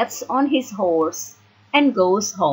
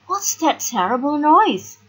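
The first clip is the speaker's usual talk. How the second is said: alarmed question; 8 semitones higher than usual. A narrator's reading. Beauty hears a loud noise.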